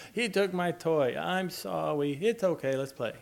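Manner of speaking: put-on a touch of childish pleading, then quickly over it; little child. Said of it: "talked about little kids not sharing".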